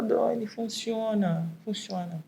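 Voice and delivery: soft, stretched-out insistence. Real, not acted. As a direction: repeat it gently and slowly for emphasis. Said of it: "eu vou falar".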